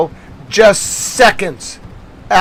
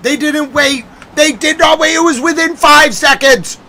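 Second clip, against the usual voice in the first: agitated explaining; 7 semitones higher than usual. Real, not acted. Streamer explaining excitedly.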